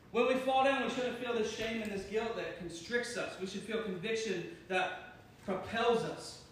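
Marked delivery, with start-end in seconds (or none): none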